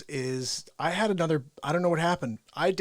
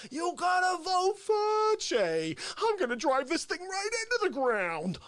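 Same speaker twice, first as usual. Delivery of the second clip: high, excitable mock host voice; Fauci character voice; 10 semitones higher, slower than usual. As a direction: keep the unhinged rival host going.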